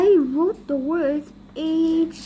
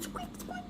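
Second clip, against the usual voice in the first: tiny, very high soft squeak; toy squeak; 14 semitones higher, 19 dB quieter than usual. Acted. Reader imitating the squeaky telephone, tiny squeak. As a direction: squeak softly like a rubber toy.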